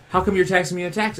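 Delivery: high, fast indignant complaint; a fed-up customer demanding answers; mock outraged question. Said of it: "and then you go".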